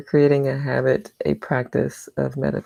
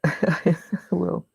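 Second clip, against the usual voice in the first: ordinary talk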